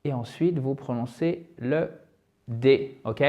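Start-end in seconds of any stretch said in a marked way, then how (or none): none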